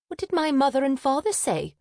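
high, urgent question; high-pitched insistent query; demanding. A narrator's reading. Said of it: "she demanded to know".

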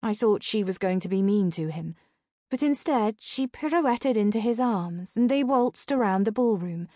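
ordinary delivery, nothing marked

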